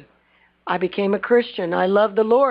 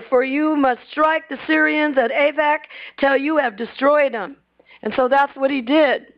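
normal talking voice

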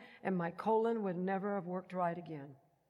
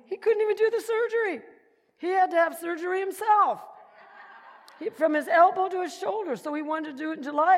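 much louder and higher, laughing in disbelief; indignant outburst; 10 semitones higher, 11 dB louder than usual. Retold or imitated. Telling the congregation about the doctor, loud and incredulous. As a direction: blurt it out, half laughing at the absurdity. Outraged retelling of doctor's plan.

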